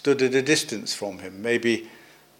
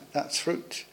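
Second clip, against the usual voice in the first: softer, whispered repeat; whispered echo; 5 semitones higher, 10 dB quieter than usual. Real, not acted. Preacher to congregation, echoing the point in a whisper. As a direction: let it sink in, hushed. Quiet echo of the same phrase.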